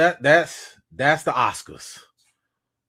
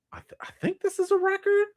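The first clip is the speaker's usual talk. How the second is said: breathless awed whisper, pitched up; stunned whisper; 3 dB quieter than usual. Real, not acted. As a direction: whisper it in disbelief at the number. Stunned by big donation.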